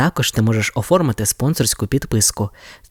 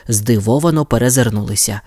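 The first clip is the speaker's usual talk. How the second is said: ordinary delivery, nothing marked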